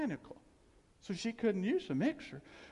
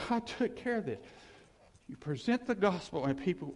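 ordinary delivery

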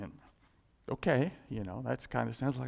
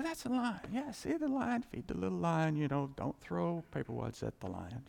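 casual zoo visitor; 2 semitones higher, 5 dB quieter than usual. Acted. Zoo visitor watching a caged lion, casual. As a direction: point out the lion lightly, no danger at all. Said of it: "if you were at a zoo and the lion is behind bars".

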